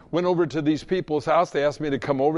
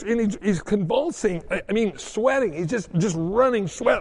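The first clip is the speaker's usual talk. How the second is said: usual speaking voice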